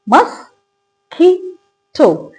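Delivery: मस्कीटो very slowly as "mus... kee... to"; three syllables. Shown as a demonstration. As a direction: break मस्कीटो into three separated beats. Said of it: "इसमें कितने syllables हैं".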